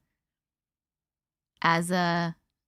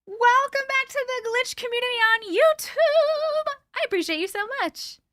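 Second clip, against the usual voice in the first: sweet welcome; 12 semitones higher than usual. Real, not acted. Welcoming a returning member to the community, high and sweet. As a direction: gush gratitude to a new member.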